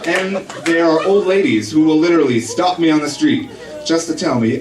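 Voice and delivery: normal talking voice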